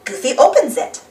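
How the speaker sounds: ordinary delivery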